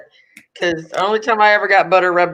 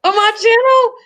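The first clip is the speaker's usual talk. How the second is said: excited; 14 semitones higher, 5 dB louder than usual. Real, not acted. Announces download news.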